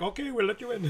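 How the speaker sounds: put-on quoting scared rebels in a mild Indian accent. Telling podcast friends how scared rebels gave in, mild mock Indian accent. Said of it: "I just made that an Indian accent".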